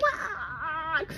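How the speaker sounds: high, drawn-out mock wail; retold freak-out. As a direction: act out a panicked wail without full volume. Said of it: "I'm, like, freaking out".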